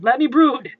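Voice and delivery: loud, petulant hero outburst; exasperated protest. Acted. Brooding hero pleading to be left alone, loud petulance. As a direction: raise pitch and volume, demanding solitude. Batman protests being disturbed.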